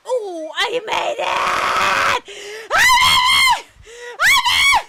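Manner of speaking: very loud, high, drawn-out victory shout; triumphant screaming. Real, not acted. Streamer celebrating making a jump, screaming in triumph. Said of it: "I probably need to stop screaming so much".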